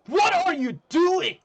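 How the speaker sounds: very high, loud, drawn-out yell; shrieked, stretched-out question; outraged scream. Acted. Bully sees papers being ripped up.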